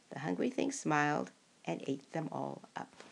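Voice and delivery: ordinary delivery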